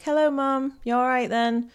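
chirpy English-accented hello. Shown as a demonstration. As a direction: slip straight into an English accent. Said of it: "my English accent just, it just pops on like this".

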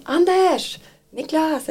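high mock call for someone. Patient calling partner over during a video visit, mock calling out. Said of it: "och så ropar de".

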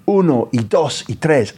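ordinary delivery

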